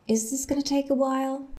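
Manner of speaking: Is this gonna take a while? said fast, high and lively; a quick, bright question; intonation demo. Shown as a demonstration. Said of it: "Even the intonation should be important".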